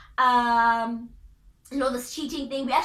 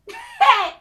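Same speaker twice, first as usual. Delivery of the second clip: high laugh; 8 dB louder than usual. Real, not acted. Laugh after sad remark.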